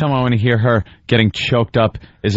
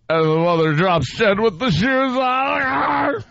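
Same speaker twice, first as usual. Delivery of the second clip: higher, cracking mock cry; hammed-up tearful wail; mock sobbing; 9 semitones higher than usual. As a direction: blubber out the ending. Fake crying over sad song.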